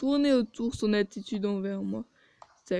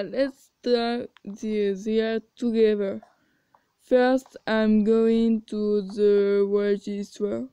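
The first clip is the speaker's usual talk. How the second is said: put-on slow, loud English with a heavy French accent; 4 dB louder, much slower than usual. English teacher greets her class in slow, loud French-accented English.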